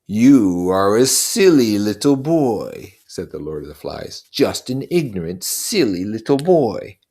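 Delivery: put-on a buzzing devil taunting a child; Lord of the Flies, mocking. Lord of the Flies taunting Simon, slow and sneering.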